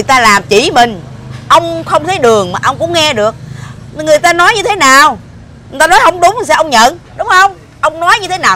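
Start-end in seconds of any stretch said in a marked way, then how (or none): 3.9-8.6 high, loud accusing questions; angry scolding. Acted. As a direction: corner him, voice rising. Wife scolds husband over lawsuit.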